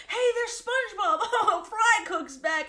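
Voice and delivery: high, laughing cheer; enthusiastic cheering. Retold or imitated. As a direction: cheer him back like a happy crowd. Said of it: "really enthusiastically like".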